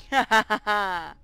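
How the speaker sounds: loud gleeful ha ha ha; pirate cackle. Acted. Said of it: "Once Jack and I finish cackling".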